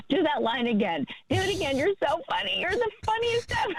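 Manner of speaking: high-pitched mock fangirl begging; gushing fan. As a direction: imitate yourself gushing at a co-star. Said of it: "I was his biggest fan. I was dying laughing".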